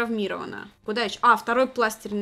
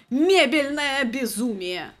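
mock announcer; 2 semitones higher, 6 dB louder, much slower than usual.

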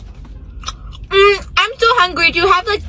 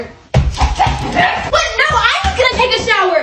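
outraged what; 6 semitones higher, slower than usual. Acted. Sibling outraged over shower.

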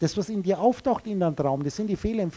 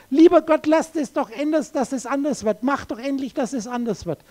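mock plea to God, pitched up; pleading prayer; 4 semitones higher than usual. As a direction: imitate someone begging God for change. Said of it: "betet zu mir".

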